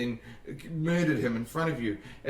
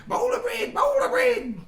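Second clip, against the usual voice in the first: very high, screeched character shout; urgent calling out; 6 dB louder than usual. Acted. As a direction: holler shrilly through a closed door. Banging on the door, calling.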